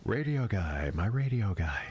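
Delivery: low, far-off echo of the surgeon's voice; doctor's voice remembered. Retold or imitated. The surgeon's words echoing in [name]'s head, low and distant. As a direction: hear it as a haunting memory, pitched low. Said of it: "Doctor Golding's voice in my head saying".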